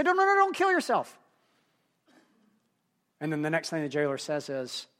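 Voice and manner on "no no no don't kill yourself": high-pitched urgent protest, no louder; urgent plea. Retold or imitated. Prisoners stopping the jailer from killing himself, urgent high plea. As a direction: imitate frantically stopping someone, pitch leaping up.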